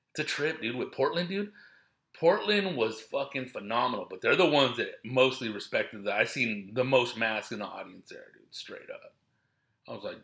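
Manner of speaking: ordinary talk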